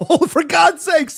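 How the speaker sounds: loud, high exasperated outburst; exasperated shout. Real, not acted. Cohost arguing Arnold is too old, an exasperated loud outburst. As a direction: blurt it out at full volume.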